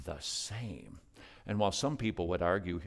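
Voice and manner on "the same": hushed. Real, not acted. Repeated hushed answer.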